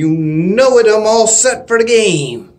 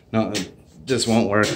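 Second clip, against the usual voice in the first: normal talking voice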